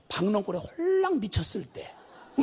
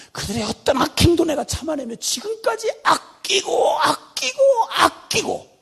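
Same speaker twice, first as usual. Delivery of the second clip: impassioned cry; 8 semitones higher, 3 dB louder than usual. Acted. God pleading for Nineveh.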